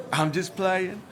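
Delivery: said through a chuckle; laughing. Acted. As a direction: laugh while you say it. Teasing after a jab.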